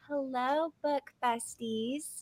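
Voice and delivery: gentle booktuber greeting; imitating Jordan. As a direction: ease it out like a cozy book vlogger. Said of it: "Jordan's is".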